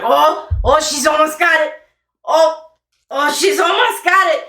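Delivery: high, loud and eager, rising as she nears it; excited Thimblejack. Acted. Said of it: "And you hear Thimblejack".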